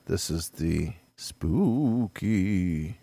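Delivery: mock spooky. Co-host hamming up a horror theme, mock spooky. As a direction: stretch it out eerily, low and slow.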